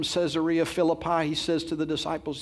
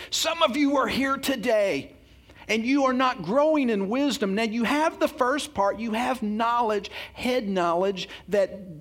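full-force preaching; 6 semitones higher than usual. Acted. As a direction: drive the point home from the pulpit.

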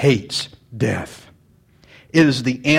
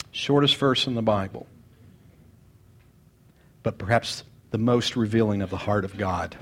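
quiet, lowered reflective delivery; soft first reading; 6 semitones lower, 6 dB quieter than usual. Shown as a demonstration. Pastor reading the verse softly before repeating it. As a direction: murmur it low, to yourself. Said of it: "repeats line softly then loudly".

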